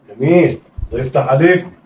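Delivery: usual speaking voice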